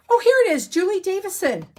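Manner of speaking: high, loud burst of delight; suddenly thrilled to find it; excited find. Real, not acted. Crafter finds the designer's name in her notes, excited.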